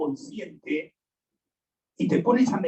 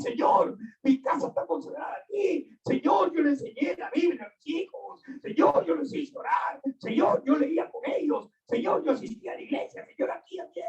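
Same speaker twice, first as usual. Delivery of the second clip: pleading believer; 7 semitones higher, 3 dB quieter than usual. Retold or imitated. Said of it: "A esos son los que van a venir".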